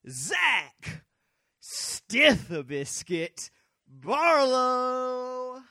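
booming announcer, slow and drawn out, last name stretched long and loud; ring announcer. Acted. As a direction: hype the partner's entrance like a wrestling ring announcer. Mock ring announcer intro.

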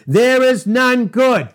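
slow, loud, stress on is; emphatic quote. Acted. Preacher booms Jesus's words at his congregation, emphatic. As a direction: lean hard on "is", slow and loud.

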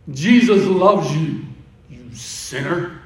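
mock preachy scorn. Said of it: "the way you say it".

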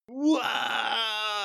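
high, breathy amazed wow; mock crowd awe. The crowd watching the dunk, mock amazed. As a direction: gasp it like an awed crowd. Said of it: "everyone's like".

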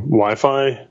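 ordinary delivery

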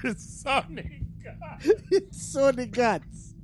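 high laughing squeaky repeat; cracking up, voice climbing high. Real, not acted. Laughing, high-pitched joking.

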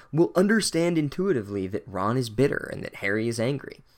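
ordinary talk

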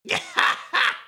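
high, breath-heavy evil laugh; villain cackle. Acted. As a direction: cackle after the boast. Kubo cackles after villain boast.

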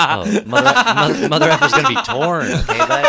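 high, loud words through laughter; laughing. Real, not acted. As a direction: laugh out every word. Laughing after juice prank.

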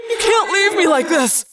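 anguished high-pitched yell; desperate cry. A narrator's reading. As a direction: cry out as if being abandoned. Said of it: "Arbron cried".

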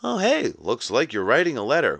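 high, sing-song cartoon helper voice; chirpy animated paperclip. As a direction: pipe up as an over-eager office assistant. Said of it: "this little animated paperclip being like".

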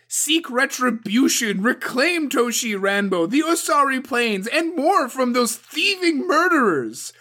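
loud, high, relentless tirade; furious demand. A narrator's reading. Suko pressures Toturi to act, loud furious harangue. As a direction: browbeat the new champion.

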